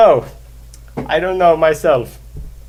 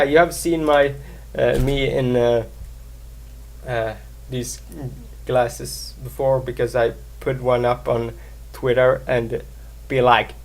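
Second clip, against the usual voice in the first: normal talking voice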